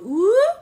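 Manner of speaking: high, loud teasing ooh; a big high mock coo. Retelling a bar kiss, high loud mock ooh. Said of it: "she does the old, the old".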